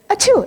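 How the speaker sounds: high, loud mock "achoo"; pretend sneeze. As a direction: fake a big sudden sneeze. Imitates a sneeze for the example.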